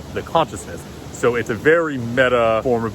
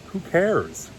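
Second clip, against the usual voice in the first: dismissive; 7 semitones higher than usual. Real, not acted. Dismissive quip, pitch jump.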